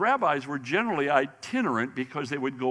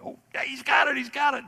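much higher, louder, cheering Joseph; excited father; 14 semitones higher, 4 dB louder, faster than usual. Retold or imitated. Joseph running behind young Jesus on a bike, cheering loudly. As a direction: cheer on a kid learning to ride. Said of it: "I picture Joseph, you know, kinda".

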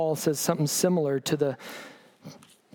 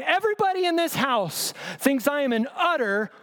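imagined exasperated believer; 7 semitones higher than usual. Pretend. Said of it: "are you okay with going".

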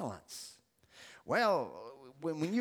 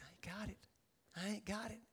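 very soft, near-whispered confession; meek admission; 16 dB quieter, faster than usual. Acted. Debtor telling the king he's broke, meek and hushed. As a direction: say it under your breath. Servant admits he cannot pay.

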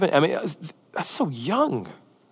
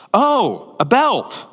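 polite disappointment; 8 semitones higher, 4 dB louder, much slower than usual. Retold or imitated. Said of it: "If they repeat the name of the gift".

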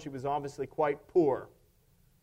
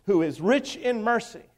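ordinary talk, nothing marked

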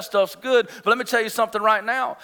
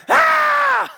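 shrill mock tantrum cry, much higher and louder; high toddler shriek; 6 dB louder than usual. Father contrasting his two sons' tantrums, high shriek. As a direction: squeal it like the younger son. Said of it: "he's like".

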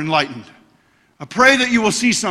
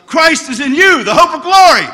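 proclaiming; 8 semitones higher, 7 dB louder than usual. Real, not acted. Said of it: "proclaims scripture".

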